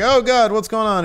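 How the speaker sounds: high, loud mock alarm; hammed-up worried wife, pitch jumping; concerned. Wife sees her husband in pain, alarmed. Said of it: "She's understandably concerned".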